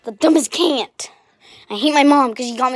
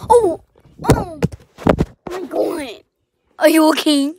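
mock alarm; 5 semitones higher, 4 dB louder than usual.

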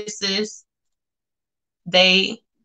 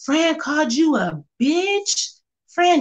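shocked; 7 semitones higher, slower than usual. Real, not acted. Shocked by a viewer's comment.

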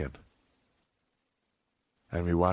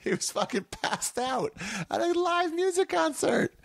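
mock high voice. Mocking friend, high voice.

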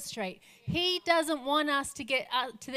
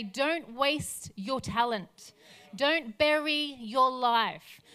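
slow pleading charge; slower than usual. Retold or imitated. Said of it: "He's wanting to stir them to say".

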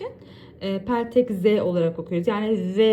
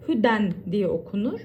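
usual speaking voice

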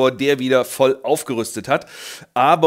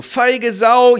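much higher and louder, a put-on bellow; mock angry shout; 10 semitones higher, 7 dB louder than usual. Morgoth insulted at his gate, hammed-up angry bellow. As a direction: bark back in comic rage.

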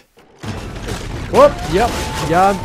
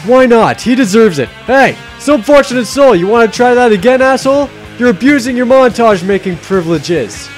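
angry taunting; 6 semitones higher, 7 dB louder than usual. Acted. Yelling at the creator.

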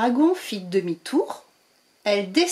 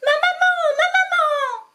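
very high joyful calling out. Acted. Baby dragon calling joyfully to his mother, very high voice. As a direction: squeal for mummy with glee. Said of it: "appela-t-il".

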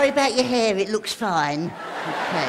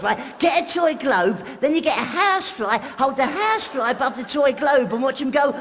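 normal talking voice

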